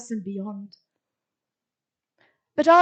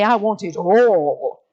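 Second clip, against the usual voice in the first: mock greedy; 4 semitones lower, 8 dB louder, slower than usual.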